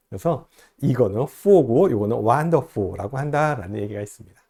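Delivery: ordinary talk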